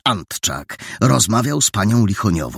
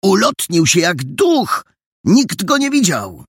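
excited awe; 9 semitones higher than usual. A narrator's reading. Boy goes on excitedly.

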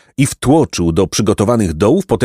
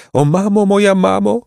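high, plaintive wail for mother; keen in a small, high sobbing voice; whimpering; 11 semitones higher than usual. A narrator's reading. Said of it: "chlipał wciąż Placek". (wysokie, żałosne chlipanie)

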